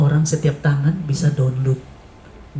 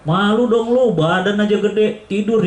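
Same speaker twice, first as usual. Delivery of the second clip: mocking taunt; 4 semitones higher, much slower than usual. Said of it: "ketawain sama teman-teman".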